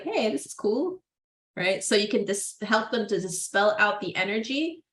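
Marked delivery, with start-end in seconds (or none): 0.0-1.0 put-on delighted child. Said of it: "She's like".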